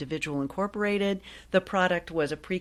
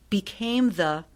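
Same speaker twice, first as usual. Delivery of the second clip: normal talking voice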